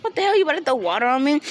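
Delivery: high, quick mock alarm; imitating startled outrage. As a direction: jump back in pitch, acting out his shock. He jumps back from spilled water.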